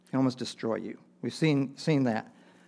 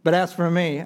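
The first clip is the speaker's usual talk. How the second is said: "But as for me" raised high and loud; turn of tone; 5 semitones higher, 7 dB louder than usual. Retold or imitated. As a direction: swing up strongly on "But as for me". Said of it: "turns the tone".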